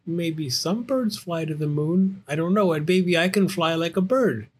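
high bear cub, arguing back; hopeful Little Bear. Acted. Little Bear insisting he can fly, high and hopeful. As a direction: play a stubbornly hopeful young bear.

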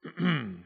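formal throat-clear before a speech. A narrator's reading. Wildcat readies his verdict, officious throat-clearing. Judge prepares to speak.

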